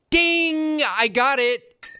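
high bright eureka ding; idea ding. Pretend. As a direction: sing out the ding, then realize. Lightbulb moment, mock realization.

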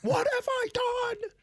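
squeaky high, slowed mock horror; falsetto mock dismay. Son mimics mom realizing who Marge Schott is, falsetto mock dismay. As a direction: cry it out in a shrill put-on falsetto. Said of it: "Once she finds out".

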